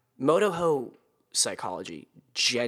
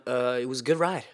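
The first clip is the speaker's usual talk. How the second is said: ordinary talk, nothing marked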